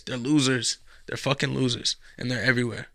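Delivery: usual speaking voice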